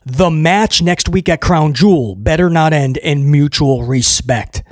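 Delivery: ordinary talk, nothing marked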